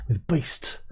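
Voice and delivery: mock spooky whisper. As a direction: breathe the words out like a ghost story. Reading spooky weather headline.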